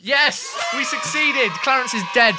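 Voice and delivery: triumphant yelling; excited shout. Real, not acted. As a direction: cheer it loud and high. Celebrating a win.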